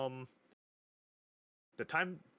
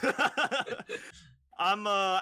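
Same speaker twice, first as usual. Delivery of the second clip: real laugh breaking through the words; 10 semitones higher, 6 dB louder than usual. Real, not acted. Guest laughing as he owns up to his real age, laughing. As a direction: giggle out the stumbling start, high and loud.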